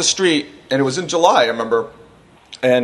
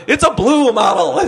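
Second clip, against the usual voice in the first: high, drawn-out answer on the edge of laughing; clueless caller; 7 semitones higher than usual. Retold or imitated. Witness asked for car's model by 911, high and clueless. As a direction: answer high and slow, comically out of depth.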